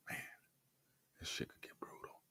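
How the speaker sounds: a hushed whispered aside. Real, not acted. Host whispering to viewers about the grim program. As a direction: lean in and breathe it.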